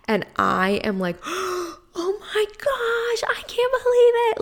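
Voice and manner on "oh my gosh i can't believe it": breathless high whisper, near tears; stunned gasp. Retold or imitated. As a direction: gasp it out, unable to believe your luck. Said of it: "I'm freaking out".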